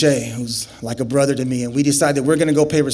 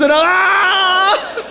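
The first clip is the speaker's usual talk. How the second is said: sudden high shriek as the pepper burns his eyes; pained scream. Retold or imitated. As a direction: let out a sharp burning yelp. Wiping pepper acid into eyes.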